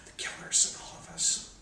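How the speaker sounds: eerie whisper. Acted. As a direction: lean in and whisper the threat.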